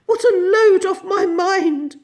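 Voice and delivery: high, loud wail of relief; overwrought, relieved cry; sighing relief. A narrator's reading. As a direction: gush with relief. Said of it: "sighing loudly".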